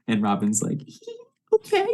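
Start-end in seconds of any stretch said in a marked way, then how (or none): 1.5-1.9 tiny, very high-pitched reply; hammed-up high girlish swoon; smitten squeak. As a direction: squeak it like a flustered admirer, about to giggle. Smitten nurse answers suave doctor.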